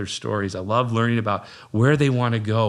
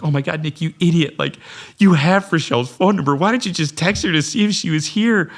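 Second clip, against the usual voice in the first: self-scolding; 7 semitones higher, 5 dB louder than usual. Retold or imitated. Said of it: "I almost started crying".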